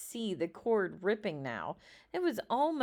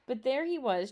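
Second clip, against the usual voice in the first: ordinary delivery, nothing marked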